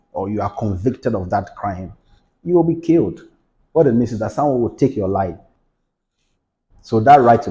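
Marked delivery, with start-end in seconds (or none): none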